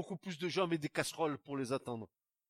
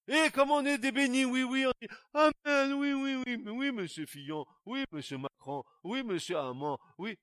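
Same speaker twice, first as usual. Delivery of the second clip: higher, louder sarcastic yes-yes; mock eager amen; 9 semitones higher, 6 dB louder than usual. Preacher mocking believers who nod amen, sarcastic high eager yes. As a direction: ham up the gullible believers nodding along.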